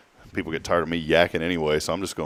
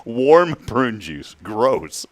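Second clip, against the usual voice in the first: slow, louder, grossed-out emphasis; slow disgusted drawl; 3 semitones higher, 4 dB louder, much slower than usual. Acted. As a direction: drag out warm prune juice, a bit louder. Savoring disgust, drawn out.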